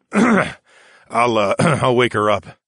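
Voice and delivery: groggy, throat-clearing reply. A narrator's reading. Man just woken answering sailor, clearing his throat. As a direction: clear the throat between words. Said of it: "I cleared my throat".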